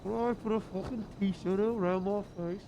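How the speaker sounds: muffled through a t-shirt. Real, not acted. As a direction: talk through cloth over the mouth. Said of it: "It's not muffled enough".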